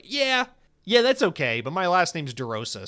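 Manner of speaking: dismissive retort. Retold or imitated. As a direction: wave the heritage talk away, half-mocking. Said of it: "And it's like".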